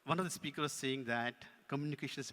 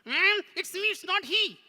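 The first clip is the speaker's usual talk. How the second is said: high, loud puppet voice; insistent puppet; 12 dB louder, slower than usual. Pretend. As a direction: claim the credit as the puppet. Puppet claims it is talking.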